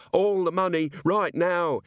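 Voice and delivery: high, mock-screamed robbery demand. A narrator's reading. Robber to the barman over a crossbow, screamed. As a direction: scream it in a high pitch, without full volume. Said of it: "he screamed".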